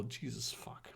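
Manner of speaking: muttered under the breath; whispered aside. Real, not acted. Reader reacting to the fanfic, whispered curse. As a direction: hiss it to yourself.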